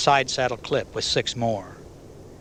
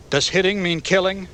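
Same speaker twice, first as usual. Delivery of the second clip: higher, louder, demanding; hard question; 8 semitones higher, 4 dB louder than usual. A narrator's reading. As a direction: press him for the truth. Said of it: "Jerry asked".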